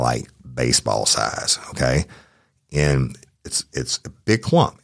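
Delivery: ordinary delivery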